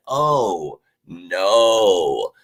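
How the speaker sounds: long, drawn-out "oh no", sinking at the end; slow, falling mock dismay; mock dread. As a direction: draw out each word in pretend doom. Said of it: "every team looking at their schedule going".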